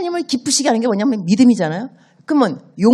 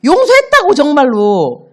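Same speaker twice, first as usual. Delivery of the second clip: much higher, loud, slow exasperated shout; angry father; 8 semitones higher, 7 dB louder than usual. Acted. Father furious that his son won't believe he's forgiven, shouting. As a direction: shout it like you've said it a hundred times. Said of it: "얼마나 화가 나겠어요".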